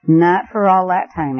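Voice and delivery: plain reading voice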